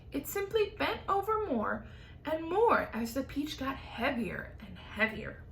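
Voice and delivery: usual speaking voice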